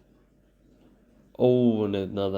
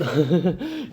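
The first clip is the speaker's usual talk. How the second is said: real burst of laughter; 9 semitones higher, 7 dB louder than usual. Real, not acted. Reacting to the film.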